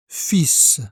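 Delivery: "Fils" said fis, final S sounded and L silent; final S demo. Shown as a demonstration. Teacher to learner, modelling the word: S heard clearly. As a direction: hiss the S at the end of "Fils". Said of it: "on prononce le S final".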